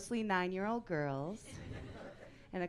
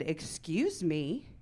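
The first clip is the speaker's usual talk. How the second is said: slow, drawn-out affronted question; much slower than usual. Retold or imitated. Mother answering the woman who doubted her, affronted. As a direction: stretch it out, offended.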